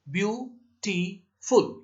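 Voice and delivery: Beautiful in three slow, separate beats; syllable by syllable. Shown as a demonstration. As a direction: break beautiful into byu, ti, ful. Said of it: "ये तीन आपके syllable हैं".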